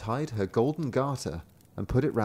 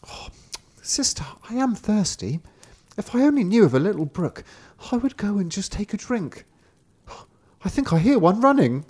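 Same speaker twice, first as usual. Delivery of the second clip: little brother's voice; 10 semitones higher, 4 dB louder than usual. A narrator's reading. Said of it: "Then the brother said".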